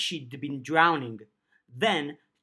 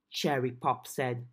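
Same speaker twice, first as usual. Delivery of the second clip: plain reading voice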